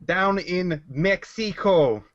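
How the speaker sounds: Mexico drawn out slowly and high as "Mex-ee-co"; over-corrected pronunciation. Acted. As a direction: stretch Mexico with a hard x, hammy accent. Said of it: "There, much better".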